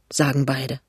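plain reading voice

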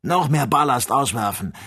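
high loud shouted command; shouted order. A narrator's reading. The doctor shouting to Joe over the sandstorm. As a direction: yell it over the storm. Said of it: "rief der Doktor Joe zu".

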